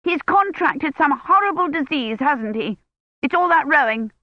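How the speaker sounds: a busybody storming in, sure of the worst; fussy old woman. A narrator's reading. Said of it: "steaming down the corridor toward them".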